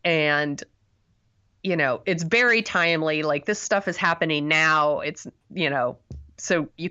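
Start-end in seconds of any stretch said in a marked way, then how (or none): none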